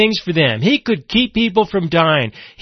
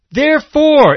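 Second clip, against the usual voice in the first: ordinary delivery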